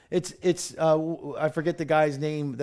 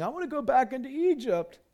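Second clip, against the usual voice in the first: high-pitched mock whine; put-on grumbling, pitched well up; mock complaining; 8 semitones higher than usual. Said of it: "The people are grumbling and complaining".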